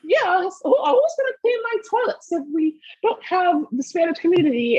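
high-pitched mimicry of a TV panelist. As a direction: put on a high, mocking voice. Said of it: "[name] on The View when she was like".

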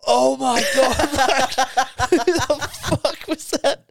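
high, loud disgusted outcry broken by laughter; appalled, laughing exclamation; mock horror. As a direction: imitate a group hit by a stink. Acting out disgusted group.

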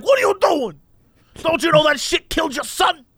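high-pitched, put-on indignant rant; scolding stranger voice. Stranger scolding the shroom user in his story, mock indignant. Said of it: "He's like".

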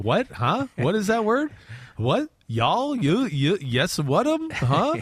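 imitating total confusion, each query pitched upward; mock bafflement. Host retelling first hearing Kentucky relatives talk, comically baffled. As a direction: fire off short, hammed-up puzzled questions.

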